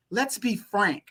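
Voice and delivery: ordinary delivery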